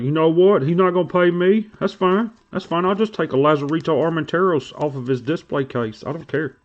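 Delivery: high, whiny sulking voice imitating Landon. Mocking Landon who sulks about not getting paid, high whine. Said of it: "Landon's already crying".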